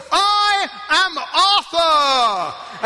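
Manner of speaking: loud, slow, grand proclamation; shouting proudly. Retold or imitated. Reenactor in a field booming his name, loud and grand. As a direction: bellow it like a king on a field. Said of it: "say out loud at the top of your voice".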